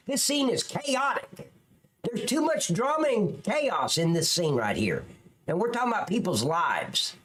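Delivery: ordinary talk, nothing marked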